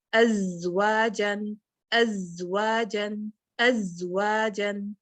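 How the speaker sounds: ازواجن slowly with a long buzzing ز; recitation with buzz. Shown as a demonstration. Teacher reciting a Quran word to show ز: slow recitation. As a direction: draw out the ز in ازواجن. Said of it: "اگر ہم یہ وسل نہیں لے کے آئیں گے".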